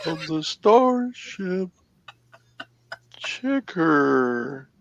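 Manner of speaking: high, slow robotic announcer; automated voice. Acted. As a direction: announce like a ship's computer. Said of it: "I try to do an automated voice".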